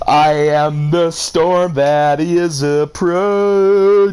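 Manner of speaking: slow solemn game line; Raiden Shogun. Retold or imitated. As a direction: intone it like a dramatic anime goddess. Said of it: "Raiden Shogun".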